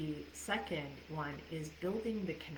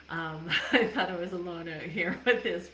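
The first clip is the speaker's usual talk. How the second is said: laughing reply; 9 dB louder than usual. Real, not acted. Reacts to viewer comment.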